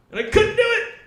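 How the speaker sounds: high, loud strained confession; comic exasperation. Retold or imitated. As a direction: cry it out high, hammed-up helplessness. Retelling his failed attempt.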